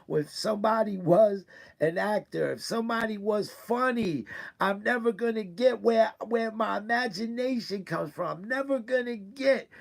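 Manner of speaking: straining voice as the hurt spills over; hurt, welling up. Real, not acted. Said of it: "Again, I'm hurt".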